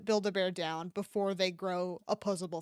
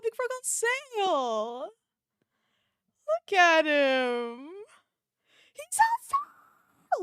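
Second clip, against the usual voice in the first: squealing delight; 9 semitones higher, 4 dB louder, slower than usual. Real, not acted. Squealing over big frog.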